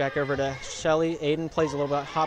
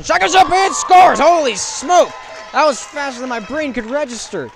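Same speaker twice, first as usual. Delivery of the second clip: very high, very loud joyful yell; excited shout; 12 semitones higher, 15 dB louder than usual. Real, not acted. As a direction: scream the basket with pure joy. Scoring call in live commentary.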